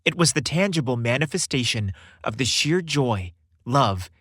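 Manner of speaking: plain reading voice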